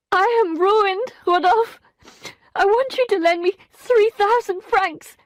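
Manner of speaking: high, loud, tearful plea; weeping plea. A narrator's reading. Emma weeping as she begs Rodolphe for money. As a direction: beg through tears. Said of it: "began to weep uncontrollably".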